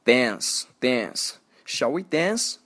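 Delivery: slow American "dance" with the a of "cat"; American accent. Shown as a demonstration. Teacher invites to dance as example, American accent. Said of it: "英国人会读".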